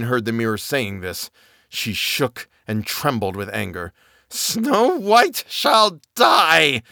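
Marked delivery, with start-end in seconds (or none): 4.3-6.8 high, very slow raging cry; furious shout. Acted. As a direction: scream it with fury. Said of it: "she shouted".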